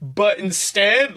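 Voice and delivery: ordinary talk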